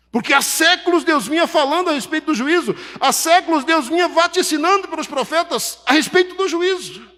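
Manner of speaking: high, loud pulpit cry building in volume; impassioned preaching. Real, not acted. Preacher on centuries of prophetic warnings of judgment, impassioned crescendo.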